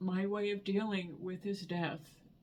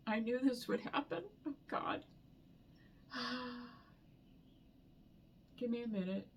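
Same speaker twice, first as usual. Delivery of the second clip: higher voice catching, whispered oh God between; fighting back real tears, a sigh; breaking into tears; 5 semitones higher, 3 dB quieter than usual. Real, not acted. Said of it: "I knew I was gonna cry".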